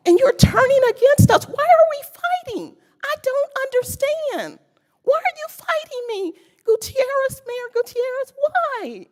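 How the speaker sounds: high, pleading cries of why; emotional pleading. Real, not acted. Resident pleading with the mayor, high and emotional. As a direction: beg them to understand, near tears. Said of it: "But I am just so emotional".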